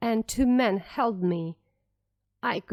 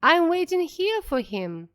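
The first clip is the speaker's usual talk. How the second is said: a mild read shout, pitched higher; understated narrated shout, higher voice; 8 semitones higher than usual. A narrator's reading. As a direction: call it out at her through the door, lightly. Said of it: "I shouted at her".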